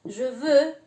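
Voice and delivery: je veux with the rounded eu vowel, ʒø vø; French je veux. Shown as a demonstration. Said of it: "Se pronuncia E".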